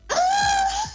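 sudden high shriek of pain; retold scream. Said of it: "I just started, like, screaming".

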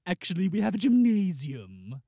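loud, stagey announcement; hammed-up period host; affected staff voice. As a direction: announce it grandly, stretching the last word. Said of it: "they speak like this".

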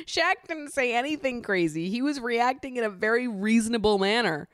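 high-pitched, insistent rebuttal; indignant. Acted. Recapper defending Shaq against Olivia's excuse, indignant. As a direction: defend him with exasperated emphasis.

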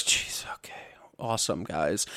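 very soft, deadpan mock praise; unimpressed onlookers. Onlookers reacting to a mall prank, unimpressed quiet mutter. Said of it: "everyone just looks at it and goes".

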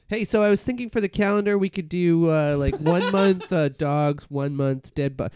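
high, loud, quick pitch of an idea; oblivious chatter. Acted. Pitching calendar ideas to someone who left, high oblivious chatter.

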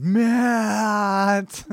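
long, high, loud holler; imitated scream. Lane yelling for Matt from outside, imitated scream. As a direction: lay on it like a car horn. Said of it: "the beautiful utterance of Lane outside".

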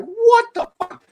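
very high, incredulous exclamation; acted-out high-pitched shock; shocked outburst. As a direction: cry out in alarmed disbelief. His dog is being attacked.

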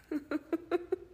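high, stuttering laugh; incredulous laughter. Real, not acted. Listener baffled by a creepy text, high incredulous laughing. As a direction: laugh in disbelief.

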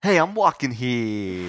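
put-on slow, drawn-out New York accent. Pedestrian bumped on the street, New York indignation. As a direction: stretch the last word in hammed-up New York. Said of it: "in the best New York accent I have".

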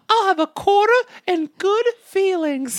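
put-on high squeaky little kid; child voice. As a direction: play a small child, very high. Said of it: "some child like".

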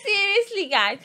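can't stop laughing mid-sentence. Real, not acted. Telling friends the story while cracking up laughing.

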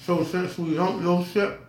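talking through a mouthful of popcorn; munching popcorn. Real, not acted. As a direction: chew and talk at once. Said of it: "excuse me, I'm eating popcorn".

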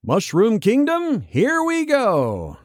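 lightly mocking the bland trailer line, drawn out slowly; Chris Pratt as Mario. Host mimics Pratt's Mario line, slow mock delivery. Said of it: "just Chris Pratt".

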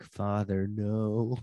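mock panic. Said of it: "I'm clearly panicking audibly".

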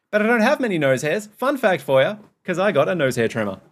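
loud, high jokey aside; excited. Real, not acted. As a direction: blurt out the fun fact. Jokey fun fact aside.

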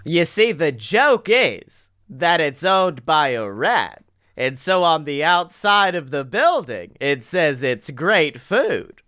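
slow, swooping stand-up cadence; hammed-up observational comic voice; stand-up comedian impression. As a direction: set up the bit with drawn-out key words. Resumes put-on comedian voice.

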